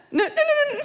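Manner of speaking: high, loud rapid no's; excited cry. Real, not acted. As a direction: call it out as you catch him. Catching a child licking lips.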